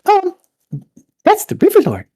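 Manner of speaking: put-on high-pitched, loud little girl figure; high, loud river-girl character voice. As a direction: pipe up in a very high voice. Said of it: "one of the girls".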